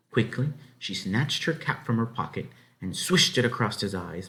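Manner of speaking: ordinary delivery, nothing marked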